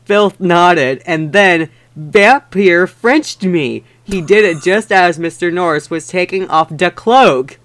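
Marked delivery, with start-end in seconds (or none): none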